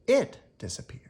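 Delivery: hushed storyteller whisper. A narrator's reading. Reading to children, whispering the candy vanished. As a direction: breathe it out like a secret.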